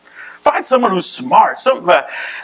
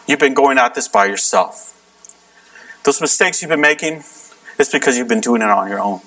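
altar call; 7 semitones lower than usual. Acted.

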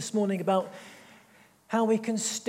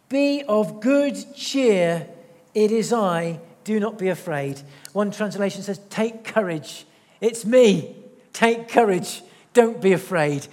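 louder, very slow, each word leaned on; emphatic key verse; 7 dB louder, slower than usual. Acted. Preacher giving Jesus' calming words, loud and deliberate. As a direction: proclaim it over the congregation.

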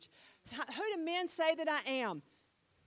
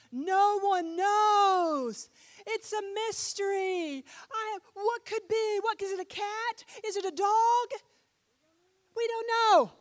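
mock mystery; 9 semitones higher, 11 dB louder than usual. Teasing about hidden object.

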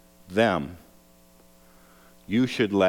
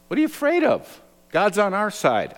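put-on much higher, eager boy's voice; bold young David; 11 semitones higher than usual. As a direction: sound like a plucky kid. Young David answers the frightened army.

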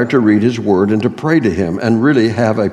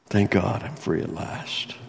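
quiet grateful Thank God; hushed thanks; 2 semitones lower, 11 dB quieter than usual. Retold or imitated. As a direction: barely voice it, grateful. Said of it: "when he says".

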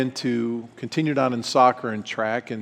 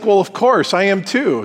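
deadpan joking confession, pitched up; 7 semitones higher, 4 dB louder than usual. Pretend. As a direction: play it as a quick tease before admitting the joke. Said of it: "just kidding".

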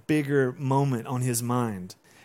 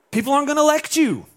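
much higher, louder, rushed protest; acted exasperation; 12 semitones higher, 5 dB louder, faster than usual. Peter warning Jesus about his election chances, exasperated. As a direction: play a panicked campaign chief objecting.

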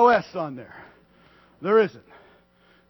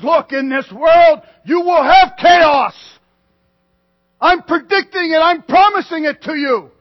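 shouted warning; 8 semitones higher, 13 dB louder than usual. Acted. Preacher voicing Christ's warning.

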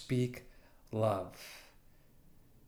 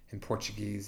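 normal talking voice

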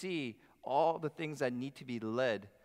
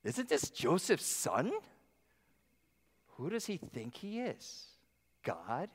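scornful townsfolk; 3 semitones higher, slower than usual. Retold or imitated. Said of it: "To later".

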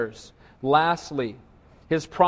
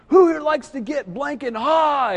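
drawn-out, higher rock-frontman crowd call; rock star impression; 7 semitones higher than usual. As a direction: ham up a stadium singer working the crowd. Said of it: "He goes".

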